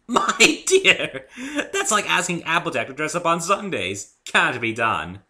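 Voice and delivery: high, loud elegant laugh through words; Rarity laughing grandly; posh pony chuckling line. A narrator's reading. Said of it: "Then she laughed".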